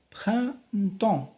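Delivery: printemps said high, nasal vowels stressed; teaching pronunciation. Shown as a demonstration. Teacher modelling printemps high for pupils. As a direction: hit printemps high and clear. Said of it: "Pierre, le".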